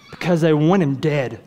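usual speaking voice

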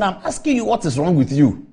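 imitating an angry caller; retold insistent demand. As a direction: press the question like an offended caller. Caller repeats his demand.

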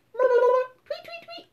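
tiny chirping bird voice; high bird tweet. Pretend. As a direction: peep it out high and small. Bird noise behind door.

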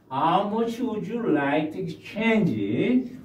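very slow, clear; slow model question. Shown as a demonstration. Teacher modelling the clerk's question slowly. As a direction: ask it slowly for learners. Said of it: "teacher models clerk question".

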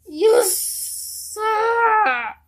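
high, loud tearful yell; crying shout. Real, not acted. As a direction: yell through tears at the villain. Furious at the villain.

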